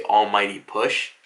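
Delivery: deep villain voice; Pain. Retold or imitated. Floating above Konoha ready to attack, deep voice. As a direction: drop low for an ominous proclamation. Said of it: "deep-ass voice".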